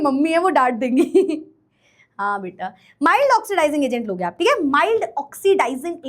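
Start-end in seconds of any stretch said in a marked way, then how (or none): 2.2-2.7 laughing low and slow; chuckles as she agrees. Real, not acted. Teacher laughing at a student's chat message. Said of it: "मुझे बहुत हंसी आ रही है".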